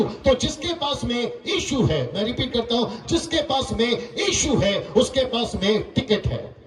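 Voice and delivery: drawn-out, ringing sermon declaration; emphatic preaching. Real, not acted. Preacher giving the congregation the key point, emphatic slow preaching. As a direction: declare it deliberately, phrase by phrase.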